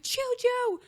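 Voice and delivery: high, slow mock call across the house; calling her son Julian to kill the bug, high and drawn out; retold yell for help. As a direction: holler for your son to come help. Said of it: "I started yelling for Julian".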